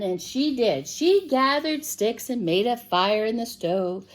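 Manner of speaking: plain reading voice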